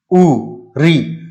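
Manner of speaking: उ and री drawn out very slowly, lips nearly closed; slow vowel demo. Shown as a demonstration. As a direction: sound out उ, री at a crawl for the class. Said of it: "जैसे आप बोलिए ई".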